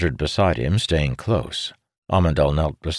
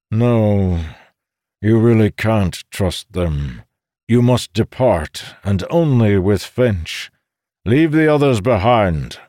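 put-on stern old man voice, slow and raised; a commanding old sage; old codger voice; 5 semitones higher, 6 dB louder than usual. A narrator's reading. Codger insisting.

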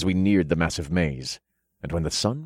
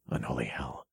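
very soft, low, stunned curse; stunned undertone; 7 semitones lower, 12 dB quieter than usual. A narrator's reading. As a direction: let it out low under your breath. Stunned by a sudden vision.

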